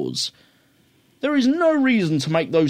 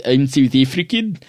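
deliberately poor South African impression; awful South African accent. Shown as a demonstration. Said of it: "my really fucking awful South African accent".

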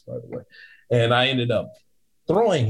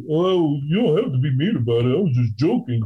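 lightly higher, whiny patron voice; mock offended patron; 4 semitones higher than usual. Bouncer imitating the patron claiming he was joking, whiny. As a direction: play it mock-hurt.

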